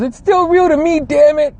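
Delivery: mock heartbroken fan. Imitating the YouTube fan who learned wrestling is fake, high wail. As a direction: whine it like your heart just broke. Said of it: "that dude that goes".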